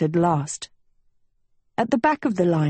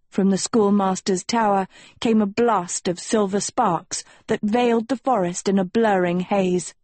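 ordinary delivery, nothing marked

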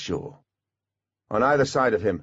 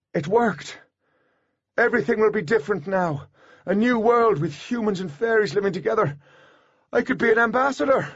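high-pitched, excited rush of relief; thrilled, racing ahead with big hopes; elated outburst; 12 semitones higher than usual. A narrator's reading. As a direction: exclaim it in triumph, pitch way up. Artemis exults as escape seems to work.

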